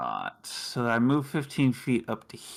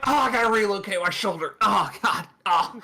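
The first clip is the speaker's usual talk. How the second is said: high, fast mock groaning; hammed-up pain; 6 semitones higher, 3 dB louder, faster than usual. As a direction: groan as if the shoulder is hurt, playing it up. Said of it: "I was like".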